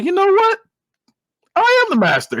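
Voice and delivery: high-pitched mock-dramatic resolve; hero's declaration. Retold hero's dramatic realization.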